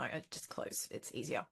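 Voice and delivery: soft, breathy giving-up mutter; defeated whisper. Retold or imitated. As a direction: let it fade out, beaten by too many choices. Giving up, overwhelmed by choices.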